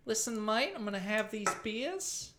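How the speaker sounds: high, tentative Kiwi accent, rising endings; shy New Zealand accent. Shown as a demonstration. Comedian demonstrating a shy Australian, hesitant New Zealand accent. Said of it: "New Zealand accent is a shy Australian".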